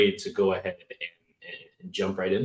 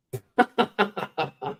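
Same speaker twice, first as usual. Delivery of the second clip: amazed high-pitched laugh; a burst of disbelieving laughter; 11 semitones higher than usual. Real, not acted. Amazed at reach stat.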